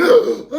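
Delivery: sharp loud gasp for air; a man gasping after being held under water. Acted. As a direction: gulp in air like you just surfaced. Surfacing from water.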